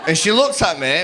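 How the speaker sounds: ordinary delivery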